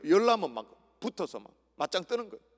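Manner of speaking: ordinary talk, nothing marked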